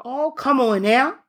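normal talking voice

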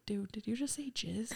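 mock-whispered aside to a buddy; hushed disbelief. Friend secretly questions him.

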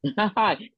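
short laugh at the symptoms; demonstrative laugh. Shown as a demonstration. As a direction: laugh it off on purpose. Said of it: "so you laugh at the symptoms".

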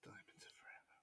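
barely audible unvoiced whisper; hushed, almost soundless repeat; whispered echo. Real, not acted. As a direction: whisper the title back under your breath. Echoes the film title under breath.

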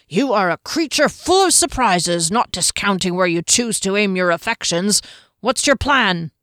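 big man teasing the halfling; hearty warrior Janek. A narrator's reading. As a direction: clap him on the back with your voice.

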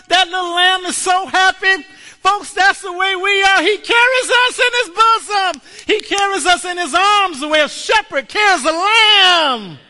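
high, loud, shouted preaching; fiery climax of the sermon; preaching at full force. Real, not acted. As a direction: build it up and shout the joy to the church.

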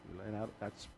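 low, soft, accusing voice telling her "you deserve it"; a hushed taunting thought in her head; quiet, condemning inner voice. Retold or imitated. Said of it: "a voice said to her, in her own voice".

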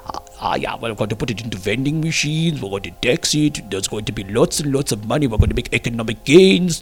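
deliberately bad German accent, businesslike; hammed-up bad German accent. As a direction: spoof German official boasting in a bad German accent. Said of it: "very bad German accent".

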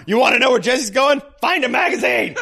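high, hammed-up shout; mock shouted retort. Riffing Dom's answer about Jesse, mock angry shout. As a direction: yell the comeback for laughs.

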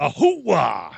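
hammy drawn-out actor's catchphrase; Al Pacino. Acted. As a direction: belt out the star's trademark whoop. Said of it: "Al Pacino".

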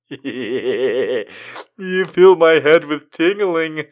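high, slow, gooey cartoon voice; lovestruck cartoon boy. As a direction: giggly swoon with hearts overhead. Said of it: "adolescent boy says when he's got hearts".

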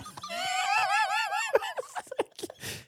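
long high wheezing laugh fit; helpless howl of laughter; crying laughter. Real, not acted. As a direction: laugh till you cry.

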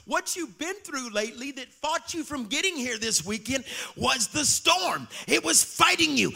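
loud, high, stretched-out preaching; shouted sermon over the storm; preaching at full force. Acted. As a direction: drive it home to the congregation. Building sermon climax.

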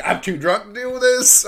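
high, loud flustered self-quote; mock drunk panic. Host quoting himself fleeing the neighbor, high and loud. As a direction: blurt it like a tipsy host caught out. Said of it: "I'm like".

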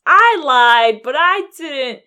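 loud, high hammed-up outburst; mock wailing confession. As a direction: cry it out like a cornered culprit. Eggsy's acted outburst in court.

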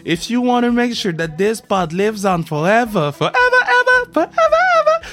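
high, excited ad pitch over music; hype promo read. Acted. Host pitching merch to podcast listeners, hyped promo read. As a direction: hype it up, soaring on the forevers.

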